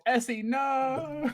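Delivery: high, long-drawn cry of alarm; mock distant shout. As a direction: call out high, stretching the "no". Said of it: "you hear everyone else shouting".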